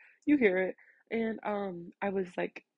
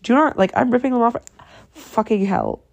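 normal talking voice

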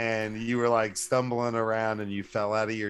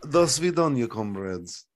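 mock Russian sign-off; Russian accent, dropping at the end. Co-host signing off to comrades, Russian accent. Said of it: "on Russian T V".

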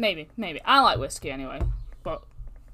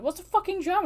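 retold outrage; 12 semitones higher, 5 dB louder than usual. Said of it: "He went".

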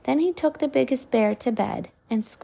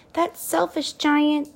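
cried; 7 semitones higher, slower than usual. A narrator's reading. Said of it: "Sophie cried".

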